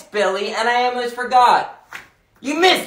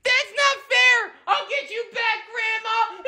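put-on whiny kid; 9 semitones higher than usual. Kid protests being grounded.

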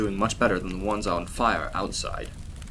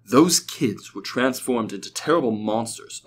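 ordinary delivery

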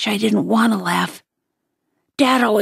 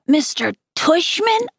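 higher, disbelieving echo of the name "Mister Tushman?"; incredulous repeat; 7 semitones higher than usual. Acted. Augie repeating the principal's odd name in disbelief. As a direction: echo "Mister Tushman?" as if you can't believe it. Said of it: "I repeated".

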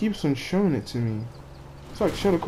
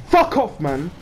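much higher, much louder outburst; angry scream; 5 semitones higher, 7 dB louder, slower than usual. Real, not acted. Gamer getting angry at the game, angry scream. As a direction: scream it in real anger. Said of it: "you're making me scream".

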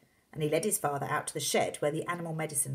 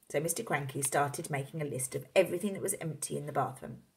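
ordinary delivery

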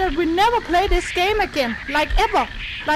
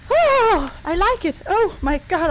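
ordinary talk, nothing marked

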